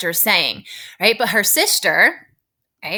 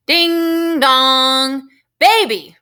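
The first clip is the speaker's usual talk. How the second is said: high, drawn-out doorbell call, then a loud shout; 6 semitones higher, 5 dB louder, much slower than usual. Acted. Teacher reads doorbell and Eugenia calling Baby, shouting high. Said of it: "shouted Eugenia".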